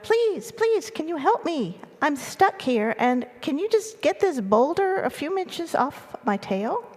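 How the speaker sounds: high-pitched plea, lightly called out; calling out for help. A narrator's reading. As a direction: plead like a small mouse calling up to a giraffe. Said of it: "Desmond yelled out to the giraffe".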